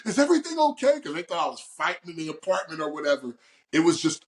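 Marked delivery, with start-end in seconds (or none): 0.1-1.0 higher-pitched imitation of a worried neighbor; mock-alarmed neighbor checking in. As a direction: call it through the door, acting concerned. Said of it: "banged on the door like".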